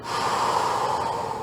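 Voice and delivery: unvoiced breath blown out; long exhale. Shown as a demonstration. Teacher showing kids how to exhale, long audible breath out. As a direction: let a long breath go for them to copy. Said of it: "And exhale".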